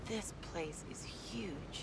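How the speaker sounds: slow, hushed whisper of wonder; whispered slowly in amazement; awed whisper. Acted. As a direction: breathe it out, taking in the vast space. Awed inside a huge cave.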